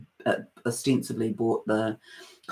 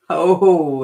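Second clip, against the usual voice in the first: louder, higher drawn-out oh; startled oh; 4 semitones higher, 9 dB louder than usual. Real, not acted. Host nearly drops the crystal she is showing, startled. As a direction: let out a startled, loud oh.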